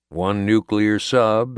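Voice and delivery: plain reading voice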